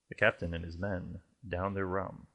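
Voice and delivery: ordinary delivery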